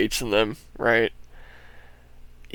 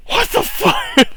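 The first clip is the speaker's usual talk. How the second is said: high, slow stunned yelp; amazed exclamation; 4 dB louder, slower than usual. Retold or imitated. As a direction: blurt it out in disbelief. Retold amazement at monster trucks.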